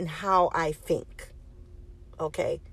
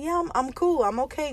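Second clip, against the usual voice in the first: high-pitched, mock-casual reassurance; insisting she's okay; 9 semitones higher than usual. Niece telling her aunt she's fine, high and overly okay. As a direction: pitch it high, brushing off concern.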